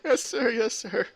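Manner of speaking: high, submissive yessing; obey meekly in a high voice; frightened guard. Acted. Guard obeying the arbitrator's threat, high and cowed.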